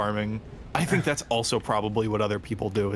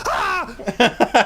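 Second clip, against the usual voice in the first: startled yelp; 13 semitones higher, 7 dB louder than usual. Shown as a demonstration. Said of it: "look at her and go".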